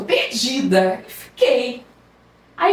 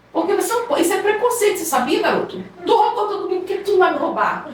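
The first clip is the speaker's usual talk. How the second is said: mock indignant demand; 6 semitones higher than usual. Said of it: "Eu falei". (indignação fingida, mais aguda e alta)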